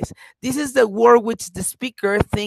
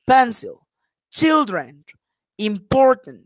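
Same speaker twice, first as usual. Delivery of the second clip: put-on "Pencil, children, important" as PEN-cil, CHIL-dren, im-POR-tant; model word stress; 3 dB louder than usual. Shown as a demonstration. Teacher reading out examples for the class, clearly stressed. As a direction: punch the stressed syllable of "Pencil, children, important", each falling after. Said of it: "these underlined words are the famous stress".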